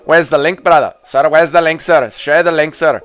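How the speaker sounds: pleading mock Indian accent. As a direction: pester in an imitated Indian accent. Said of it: "because he's half Indian".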